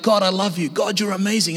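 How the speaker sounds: ordinary talk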